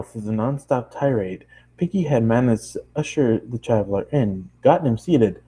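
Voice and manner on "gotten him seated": plain reading voice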